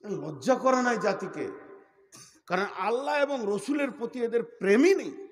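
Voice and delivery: loud, high rebuke from the pulpit; fiery preaching. Real, not acted. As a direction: thunder it at the crowd.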